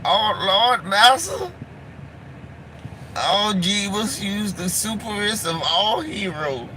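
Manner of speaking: hammed-up high servile pleading; mock servile voice. Mocking servile character voice.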